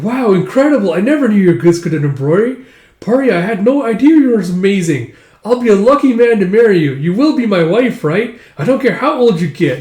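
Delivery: put-on high, loud, eager boyish voice; overflowing with delight at his bride; gushing excitement. Boy gushing over bride.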